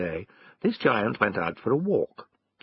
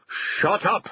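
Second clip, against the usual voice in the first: higher-pitched, irritated order; cross farmer; 9 semitones higher than usual. A narrator's reading. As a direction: cut the dog off crossly. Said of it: "said the farmer".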